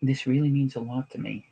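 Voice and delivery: softly earnest plea to a wife; feelings-first appeal. Shown as a demonstration. Demonstrating to his wife how to ask, soft heartfelt appeal. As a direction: speak gently and sincerely, seeking validation. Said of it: "here's how I would do it".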